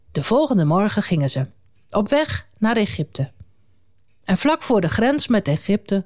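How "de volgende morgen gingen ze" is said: usual speaking voice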